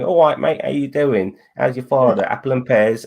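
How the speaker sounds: a touch of hammed-up Cockney; Cockney accent. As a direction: chirp it as a mock Cockney greeting. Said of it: "like, a Cockney accent saying".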